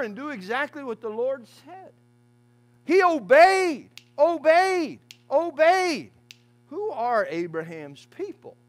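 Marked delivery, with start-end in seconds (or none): 2.8-6.1 "obeyed" hammered high and loud, slow; preacher drives home obedience; emphatic repetition. Acted. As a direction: pound out "obeyed" each time. Preacher repeats key word.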